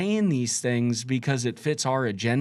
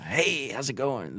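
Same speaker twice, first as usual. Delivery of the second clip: vulture salesman; 4 dB quieter than usual. Pretend. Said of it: "The vultures".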